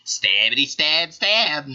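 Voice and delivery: high, slow mock battle cry; sing-song stab chant; character voice. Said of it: "he'll be like".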